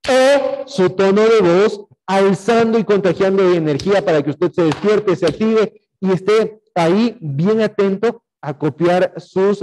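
loud, high, rousing wake-up call; high-energy pep-talk voice. Shown as a demonstration. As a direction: burst in with energy to wake the listener. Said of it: "¿Se da cuenta cómo cambia el tono de voz?".

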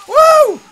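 high, loud "woo!"; excited whoop. Real, not acted. Player cheering a jump in the game, excited whoop. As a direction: let out a piercing whoop of triumph.

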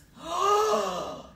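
a sharp, high, loud gasp of shock. Acted. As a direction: gasp as the creature bursts out. Crowd sees the creature.

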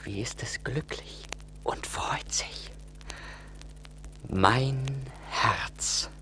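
hushed and wondering; whispering to his own heartbeat; tender whisper. Acted. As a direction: barely breathe it, moved. Peter feels his living heart.

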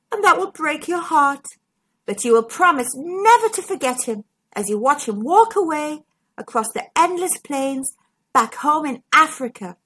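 ordinary delivery

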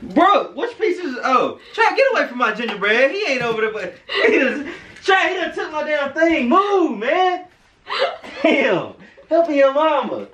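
high, laughing scold at the dog. Real, not acted. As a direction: shoo the dog away, laughing.

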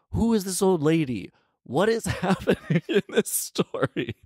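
high, bewildered questioning; laughing in disbelief; baffled. Real, not acted. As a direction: disbelief at the odd plot, voice up high. Baffled by the fairy tale.

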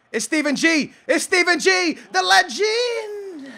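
excited hailing. Real, not acted. Gamer hails Steven G, building excited cheer. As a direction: build up and stretch the last word.

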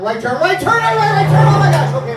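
very high, loud frantic yelling; panicked shout. Pretend. Hypnotist yelling the right turn, high panicked shout. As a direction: scream it like a near crash. Shouting turns during imaginary drive.

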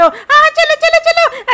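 high, loud squeaky hurry-up; frantic urging. Acted. Companion hurries everyone away from the ghost, frantic high voice. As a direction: hustle them along in a high squeal.

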